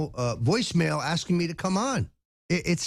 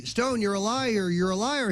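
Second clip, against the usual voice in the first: retold yelling; 7 semitones higher than usual. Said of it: "starts yelling at me".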